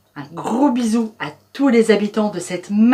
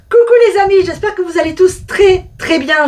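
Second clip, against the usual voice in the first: cheerful greeting; 9 semitones higher, 6 dB louder than usual. Real, not acted.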